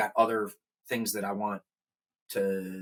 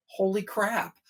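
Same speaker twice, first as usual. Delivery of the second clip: thought of astonishment; 9 semitones higher, slower than usual. Retold or imitated. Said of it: "I'm like, thinking".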